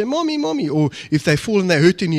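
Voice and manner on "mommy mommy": put-on high child's cry for mommy; child calling mommy. Scared child at night crying for mommy, high-pitched. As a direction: call out like a scared little kid, pitched up. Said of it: "They'll say".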